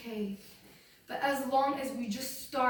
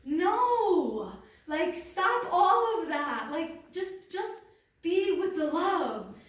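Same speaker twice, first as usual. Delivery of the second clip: emphatic; 5 semitones higher, 5 dB louder, slower than usual. Real, not acted. Urging listeners to stop self-blame.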